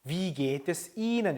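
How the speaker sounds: Wie geht es Ihnen said very slowly, each word apart; drawn-out careful German model; slow model phrase. Shown as a demonstration. Teacher to class, slowly modelling the question. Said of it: "어떻게 하면 되죠?".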